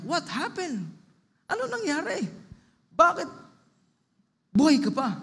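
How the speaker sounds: high-pitched mock astonishment; astonished questioning. Friends ask Margaret why she is still alive, astonished. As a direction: act out baffled, incredulous friends.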